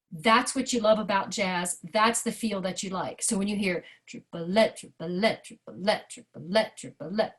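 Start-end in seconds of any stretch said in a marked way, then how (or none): 3.2-7.3 triplette with let accented and lifted; stress on let. Shown as a demonstration. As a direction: hit the lette in triplette. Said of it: "the emphasis is on the let".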